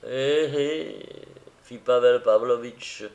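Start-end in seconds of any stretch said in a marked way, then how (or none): 0.1-1.0 sly little chuckle of words; sly smile. A narrator's reading. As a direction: say it with a knowing smile. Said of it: "avec un sourire malin". (avec un sourire malin)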